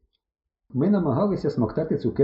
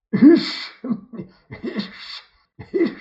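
high hissing snorts at intervals; spluttering drunken snort-laugh; bursts of bottled laughter; 12 semitones higher, 5 dB louder, much slower than usual. A narrator's reading. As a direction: let laughter escape like kettle steam. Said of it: "назовні виривався невеликими порціями".

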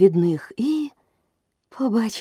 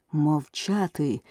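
usual speaking voice

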